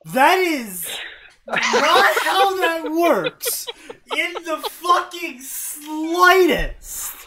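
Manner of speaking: loud, drawn-out protest, pitched high; exasperated outburst. Real, not acted. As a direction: holler each word slowly in disbelief. Outburst at an absurd story line.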